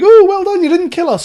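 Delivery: high-pitched, loud sarcastic praise; mock congratulation. Mocking passengers who applaud the pilot, sarcastic gushing. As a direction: gush with fake, over-the-top gratitude.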